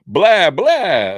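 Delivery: hammed-up Lugosi vampire; Bela Lugosi. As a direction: ham up the old Dracula blah. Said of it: "like Bela Lugosi".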